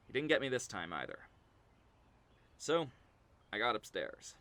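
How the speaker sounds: ordinary talk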